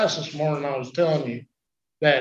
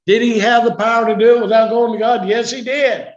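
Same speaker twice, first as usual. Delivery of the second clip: loud, higher rhetorical question and answer; preaching at full force; 7 semitones higher, 10 dB louder than usual. Acted. Preacher asks and answers for his congregation, loud preaching. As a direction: drive the point home, much louder.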